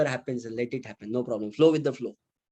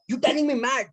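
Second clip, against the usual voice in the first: much higher, escalating outraged repeat; even angrier repeat; 10 semitones higher, 3 dB louder than usual. Retold or imitated. Insulted auto driver repeating himself, rising into a furious yell. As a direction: repeat it, pushing the fury higher. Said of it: "he became furious, angry".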